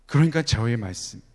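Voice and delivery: ordinary delivery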